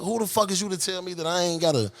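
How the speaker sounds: ordinary talk, nothing marked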